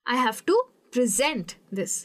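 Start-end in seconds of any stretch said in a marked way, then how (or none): 0.9-1.5 "present" as preZENT, set apart in the sentence; stress on last syllable. Shown as a demonstration. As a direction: punch ZENT in "present". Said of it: "Saint पे ज़्यादा focus".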